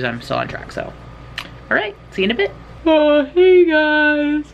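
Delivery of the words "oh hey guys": high, loud yawn through the words. Real, not acted. Vlogger greeting viewers mid-yawn, yawning. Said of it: "So, yawn right then".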